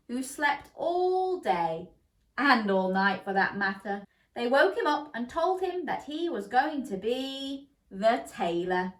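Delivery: usual speaking voice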